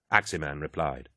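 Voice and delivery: usual speaking voice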